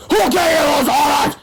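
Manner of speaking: loud, high scream. Acted. Mister Crump screaming at the kids, loud and high. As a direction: scream the demand at the children. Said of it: "screamed Mister Crump".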